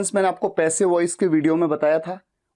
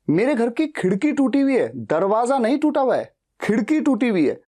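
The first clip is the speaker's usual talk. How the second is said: खिड़की pushed a little higher and firmer; stress on खिड़की; 4 semitones higher than usual. Shown as a demonstration. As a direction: lean on खिड़की, not दरवाजा. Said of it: "मैं खिड़की पे जोर दे रहा हूं".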